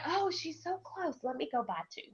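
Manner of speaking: quietly imitating team. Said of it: "And they're like".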